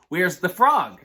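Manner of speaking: ordinary delivery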